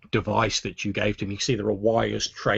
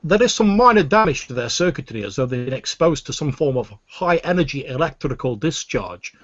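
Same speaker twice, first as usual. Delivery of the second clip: higher, louder obsequious alien voice; Weequay alien voice; 4 semitones higher, 6 dB louder than usual. Acted. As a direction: fawn over the lords in a raised alien voice. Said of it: "the Weequay's voice says".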